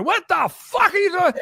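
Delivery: very high, strained outraged shout; imitated screamed profanity. Rock star learns he lost number one, mock enraged screaming. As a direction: explode down the phone, acting it out. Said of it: "I hear scream profanity".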